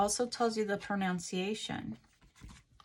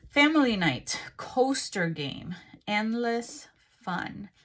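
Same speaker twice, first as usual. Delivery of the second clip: ad read; 3 semitones higher, 6 dB louder, slower than usual. Shown as a demonstration. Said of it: "reading the box".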